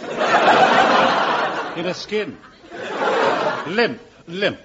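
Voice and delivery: slow, breathy, laughing aside; hammed-up pantomime horse; mock pathetic. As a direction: play the lame horse. Announcer playing a horse.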